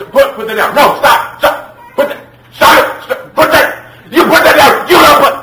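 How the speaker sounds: very high, full-volume frantic shouting; screaming parent demo. Acted. Parent screaming orders at a child to stop, screaming parent demo. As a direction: escalate into an out-of-control parent's tirade.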